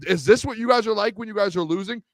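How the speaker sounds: usual speaking voice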